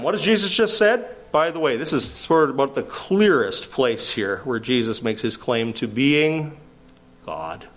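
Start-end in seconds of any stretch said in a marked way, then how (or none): none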